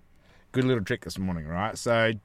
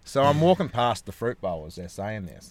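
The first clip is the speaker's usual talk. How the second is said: ordinary talk, nothing marked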